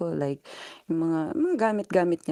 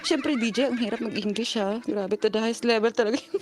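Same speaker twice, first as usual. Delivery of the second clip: ordinary talk, nothing marked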